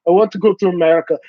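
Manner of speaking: quick put-on Iranian accent; hurried mock Iranian accent; imitated Iranian accent. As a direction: mimic an eager Iranian speaker.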